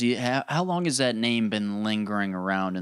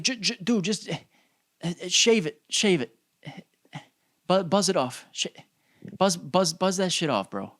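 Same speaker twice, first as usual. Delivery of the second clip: high-pitched, stammering plea; comic bafflement, voice pitched up; mock exasperation; 6 semitones higher than usual. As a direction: beg in halting, hammed-up disbelief. Mock-baffled plea to shave hairline.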